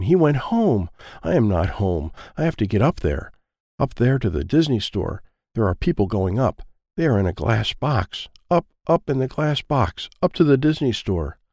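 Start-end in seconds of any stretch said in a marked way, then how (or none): none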